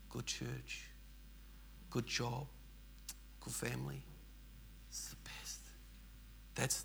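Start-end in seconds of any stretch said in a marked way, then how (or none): 4.9-5.6 hushed aside. Real, not acted. Reflective aside about good life.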